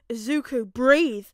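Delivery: firm call. Acted. Father breaking through Izuku's spiral, firm and loud. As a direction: snap him out of the panic. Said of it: "All for One finally breaks through".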